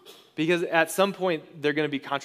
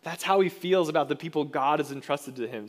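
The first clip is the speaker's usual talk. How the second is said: normal talking voice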